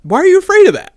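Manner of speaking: high, loud, exaggerated question; mock-incredulous squawk; retold challenge. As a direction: imitate him calling it out, voice way up. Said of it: "he's like".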